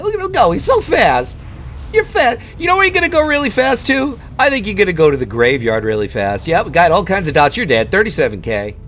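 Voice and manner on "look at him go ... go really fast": taunting. Real, not acted. Mocking a fleeing enemy player.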